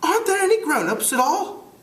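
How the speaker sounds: very high, piping British schoolboy; British accent. Acted. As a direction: play an anxious-to-be-agreed-with British boy. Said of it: "try to do it with a British accent".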